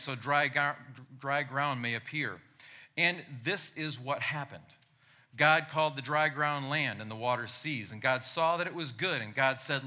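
booming biblical narration. Acted. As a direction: proclaim it like from the pulpit. Reads Genesis aloud.

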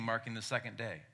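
normal talking voice

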